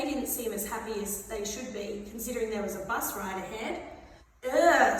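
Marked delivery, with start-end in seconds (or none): 4.4-5.0 loud groan of disgust; disgusted "ugh". Acted. Bus-stop passenger smelling Fart, disgusted groan. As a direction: groan loudly at a stink. Old man reacts to the stink.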